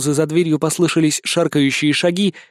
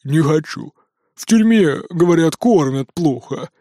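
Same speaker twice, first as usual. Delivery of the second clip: sulky. A narrator's reading. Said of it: "насупился прожорливый поросёнок".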